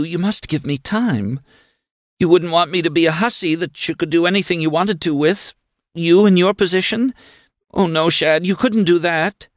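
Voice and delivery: high, pleading woman's voice; put-on sobbing. A narrator's reading. Woman stalling Shad as he grips her, tearful pleading. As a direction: plead tearfully, pitched well up. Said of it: "had the inspiration to sob".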